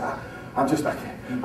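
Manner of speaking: breathless, barely voiced panic; retold panic attack, whispered; panicked whisper. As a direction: gasp it out like you can't breathe. Panicking before the speech.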